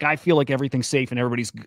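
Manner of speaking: ordinary talk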